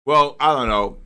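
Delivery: usual speaking voice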